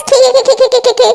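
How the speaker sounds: mock "khe-khe-khe" giggle; imitated chuckle in staccato syllables; mocking laugh imitation. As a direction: mimic her silly repetitive laugh. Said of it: "शुरू रहती है इसकी हंसी".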